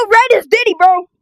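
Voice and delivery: usual speaking voice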